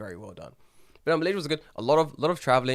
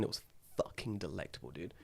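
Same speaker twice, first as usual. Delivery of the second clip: hushed, savouring whisper; 5 semitones lower, 13 dB quieter than usual. Real, not acted. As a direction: whisper it like a delicious secret. Raving about hotel laksa.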